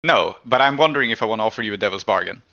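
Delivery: ordinary talk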